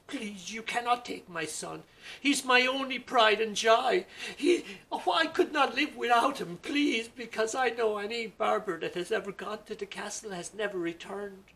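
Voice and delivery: high, loud desperate begging; pleading widow. Acted. Widow begging the guards to spare her son, high frantic plea. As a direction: beg in a high anguished old woman's voice.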